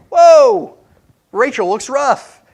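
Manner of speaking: loud, high startled whoa then slow; shocked whoa. Retold or imitated. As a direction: jolt awake in horror at her face. Husband shocked waking beside wife.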